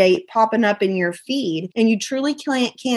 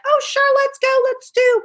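very high, a bit louder peppy mimic; mock eager friend; 3 dB louder than usual. Imitating friends who expected her to perform, very high peppy mock. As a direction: squeal it like an overeager pal. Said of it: "be like".

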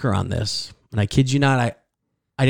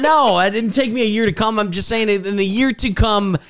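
high-pitched, flustered backpedaling; embarrassed insistence, much higher; flustered protest; 9 semitones higher than usual. Real, not acted. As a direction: scramble to explain yourself, pitch climbing. Flustered denial of accidental innuendo.

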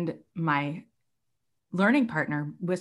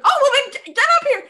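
much higher and louder, an eager summons; excited call; 10 dB louder, much faster than usual. Retold or imitated. Quoting the teacher calling her up on stage, excited. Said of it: "She said".

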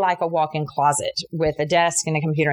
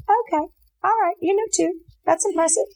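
high, sing-song polite brush-off; mock impressed; 12 semitones higher than usual. To the shop owner quoting verses, high mock-impressed. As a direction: act impressed while itching to leave. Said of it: "And I was like".

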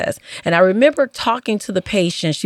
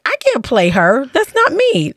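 high, insistent refusal, climbing sharply; playfully overwrought objection; retold protest; 7 semitones higher than usual. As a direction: object in dismay, voice leaping up. Retelling her protest at the role.

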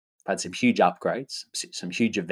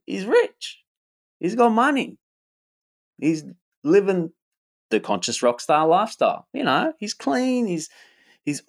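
mock hype voice; 8 semitones higher than usual. Mock hype describing himself.

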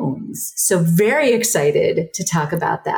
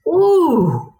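high, loud delighted ooh; a thrilled ooh at the wine; excited ooh; 11 semitones higher, 4 dB louder than usual. Real, not acted. Reacts to wine list.

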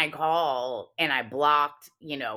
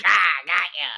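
loud playful jump-scare yell; mock pouncing shout; 2 semitones higher, 8 dB louder, much faster than usual. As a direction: imitate a kid springing out on someone. Retells child jumping out to scare.

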